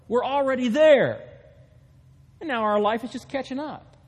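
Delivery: loud, high, emphatic preaching over the congregation; full-force preaching. Acted. Pastor proclaiming to the congregation, loud emphatic preaching. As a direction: drive the truth home from the pulpit. Preaching on being made perfect.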